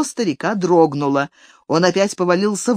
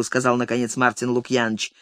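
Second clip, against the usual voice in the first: usual speaking voice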